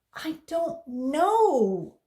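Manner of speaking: high, loud, very slow wail; scared girl. A narrator's reading. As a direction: drag out the words tearfully.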